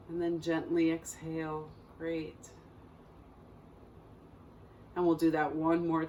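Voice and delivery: calm meditation guide with long, unhurried pauses; guided meditation. Acted. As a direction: guide listeners gently through breath and body, leaving space between phrases.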